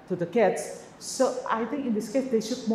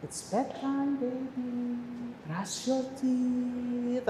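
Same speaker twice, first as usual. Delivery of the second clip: put-on soft coaxing to a child; 3 semitones higher, 6 dB quieter, much slower than usual. Shown as a demonstration. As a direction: gently coax the little one toward the sink, slow and soft. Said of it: "kan modifikasi gitu ya".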